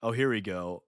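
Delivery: ordinary delivery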